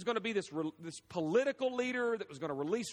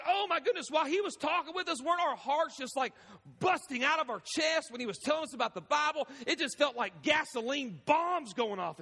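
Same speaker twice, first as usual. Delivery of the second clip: high-pitched, breathless-with-wonder retelling; worked-up, pitched-up amazement; acted excitement; 6 semitones higher than usual. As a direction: play an astonished disciple bursting to share it. Acting disciples' thrilled reaction afterwards.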